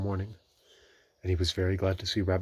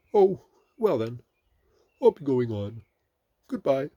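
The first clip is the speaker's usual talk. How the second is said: high, louder bear voice; departing Pooh; 7 semitones higher, 7 dB louder than usual. A narrator's reading. Pooh taking leave of Rabbit, high voice. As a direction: bid farewell in a character voice.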